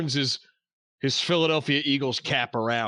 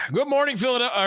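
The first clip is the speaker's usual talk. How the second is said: radio voice; 7 semitones higher, faster than usual. Acted. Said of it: "That was a great radio voice".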